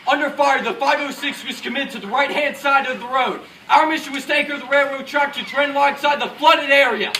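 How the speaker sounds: loud, projected mission report. Acted. Officer recounting the mission under fire, loudly. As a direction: report it over gunfire.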